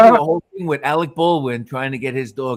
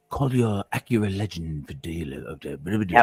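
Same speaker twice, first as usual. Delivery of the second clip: lightly imitated deep ad announcer; a hint of a smooth car ad voice; Alec Baldwin voice; 8 semitones lower, 4 dB quieter than usual. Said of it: "they used the Alec Baldwin voice".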